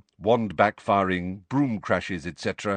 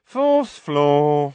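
bored; 5 semitones higher, 8 dB louder than usual. Acted. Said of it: "said the witch in a bored voice".

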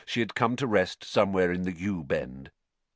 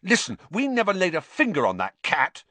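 raised, indignant denial; heated protest; 9 semitones higher than usual. A narrator's reading. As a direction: defend yourself hotly. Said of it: "said Ron hotly".

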